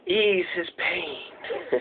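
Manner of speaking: very soft, slow whisper; drawn-out, hushed and low; hushed movie-quote whisper. Acted. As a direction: whisper it like a mysterious voice from beyond. Echoes a film's whispered line.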